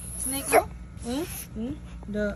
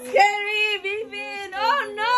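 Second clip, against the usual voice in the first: high mock fright for the child; mock scared; 7 semitones higher, slower than usual. Mother hamming fear for the child, high mock fright. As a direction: pretend to be scared of the monster.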